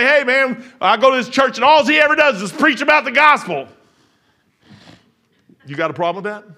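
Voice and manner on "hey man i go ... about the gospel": raised, higher complaining churchgoer; mock a loud grumbling churchgoer; mimicked complaint. Churchgoer griping to preacher about gospel sermons, loud mimicked complaint.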